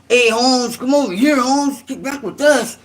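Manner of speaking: imitating a norteño recruiter in a Chicano accent. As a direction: Chicano accent, beckoning like a homeboy. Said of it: "the norteños".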